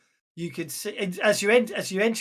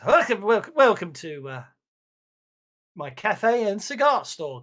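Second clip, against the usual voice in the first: louder, eager shopkeeper welcome; cheery cafe owner; 3 semitones higher, 8 dB louder than usual. Acted. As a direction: greet the guests like a proud owner. GM voices cafe owner greeting.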